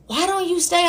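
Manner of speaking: high-pitched coaxing therapist. Retold or imitated. Therapist suggesting client stay, high-pitched and coaxing. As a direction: imitate a therapist's lilting suggestion. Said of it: "I heard my therapist be like".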